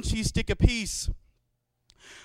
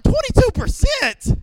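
much higher, louder, slower disbelieving cry; shocked squeal; 7 dB louder, slower than usual. Retold or imitated. Hearing the raise, high loud shocked squeal. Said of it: "No, I was like".

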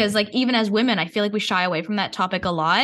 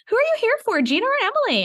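pitched way up, imitating strangers; light imitating curious voice; high mimicking voice; faster than usual. People asking at event.

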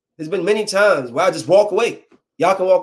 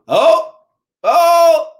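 mock shock; 13 semitones higher, 6 dB louder than usual. Reacting to rival guy.

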